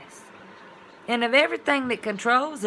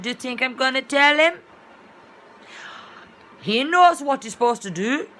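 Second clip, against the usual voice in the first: a hint of an African accent, louder and higher; African lady's accent; 6 semitones higher, 7 dB louder than usual. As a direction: lightly imitate the African employer's lilt. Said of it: "I can't even talk like that".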